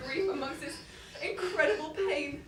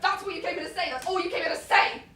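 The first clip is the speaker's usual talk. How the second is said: loud, higher, disbelieving; incredulous anger; 6 semitones higher, 10 dB louder than usual. Acted. Father scorning the killer's apology, loud and disbelieving. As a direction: throw his words back at him.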